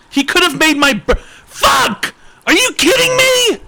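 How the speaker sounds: high, loud hammed-up fury; exaggerated comic rage; mock outrage. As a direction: scream it way up high in fake disbelief. Outrage that holiday missed his birthday.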